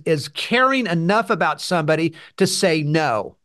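ordinary delivery

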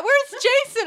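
high, loud call; yelling. Retold or imitated. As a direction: yell for him across.

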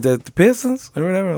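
imitating the Louisiana girl's confused question, high voice; mock girl voice. Retelling the girl's reply.

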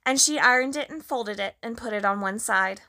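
usual speaking voice

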